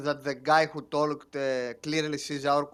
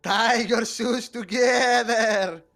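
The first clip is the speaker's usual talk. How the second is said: spooky ghost voice; 6 semitones higher, 3 dB louder, slower than usual. Acted. Ghost threat in character.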